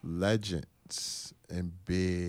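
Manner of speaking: "legends" said slowly, with a blurred final consonant; accent on legends. Real, not acted. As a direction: stretch out "legends" and let the accent show. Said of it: "With T or with a D?".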